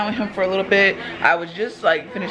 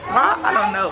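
put-on child voice. Voicing kid's question and answer.

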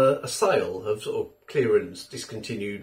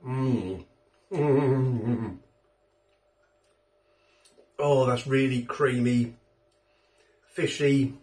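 talking between bites; chewing food; slower than usual. Real, not acted. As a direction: mouth partly full. Seen 0: Bite at -7, closed-mouth chewing, then speaks between chews holding fork.

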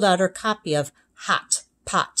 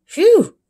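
loud high whoosh of relief; big relief; 9 dB louder than usual. Acted. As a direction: blow out relief. Relief after reading right.

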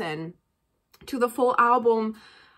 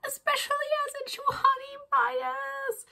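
1 giddy squeal; 12 semitones higher, 6 dB quieter than usual. Real, not acted. Fan declaring her favourite member.

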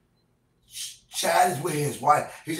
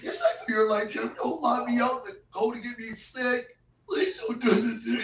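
high, whining near-sob; real crying plea; tearful pleading; 7 semitones higher than usual. Real, not acted. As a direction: beg tearfully in a raised pitch. Begging not to be locked out.

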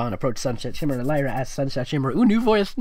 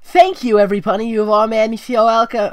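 much higher, louder joyful thanks; loud tearful joy; 9 semitones higher, 8 dB louder than usual. Acted. Sunset thanking every pony at the party, loud and high. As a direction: cry it out to the whole party.

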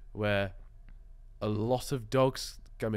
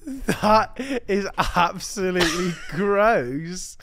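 disgusted laughing; 9 semitones higher, 9 dB louder, much slower than usual. Real, not acted. Disgusted reaction.

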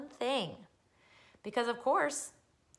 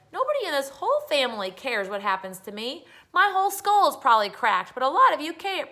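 high, loud aggrieved whine; loud complaint; 7 semitones higher, 8 dB louder than usual. Acted. Boy complains to family about his bumped head, loudly aggrieved. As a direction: build into a wounded child's protest.

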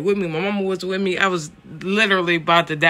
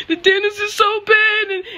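much higher, louder exasperated mimicry; mock mama's warning; 7 dB louder than usual. Daughter blaming mama for her nerves, exasperated high mimicry. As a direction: imitate a nagging mother at a high pitch.

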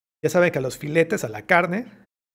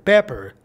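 pepper said louder, correcting; emphatic correction; 5 dB louder than usual. Shown as a demonstration. Teacher corrects paper to pepper, firmly. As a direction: insist on pepper. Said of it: "No, pepper".

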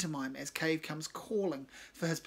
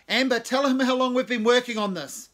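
loud demand; 7 semitones higher, 12 dB louder than usual. Acted. Cave demanding loudly.

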